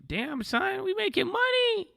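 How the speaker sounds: high, slow, stretched cry of joy; excited whoop. Real, not acted. Streamer celebrates the paper profit, excited high whoop. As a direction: sing it out with real delight.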